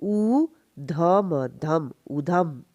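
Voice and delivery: उ alone, then धम, then उधम joined; drawn-out teaching demo of उ and धम; two syllables. Shown as a demonstration. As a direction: sound out उधम piece by piece. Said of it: "two syllable".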